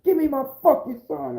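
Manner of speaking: high-pitched mock outburst; acted angry demand. Father at home demanding his son, acted anger. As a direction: demand it with the voice jumping up.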